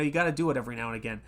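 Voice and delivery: normal talking voice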